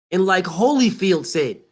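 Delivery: usual speaking voice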